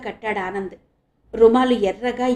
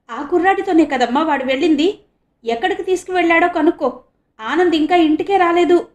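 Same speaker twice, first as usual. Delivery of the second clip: worried; 7 semitones higher, 6 dB louder than usual. A narrator's reading. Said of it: "ఆందోళనగా అన్నాడు".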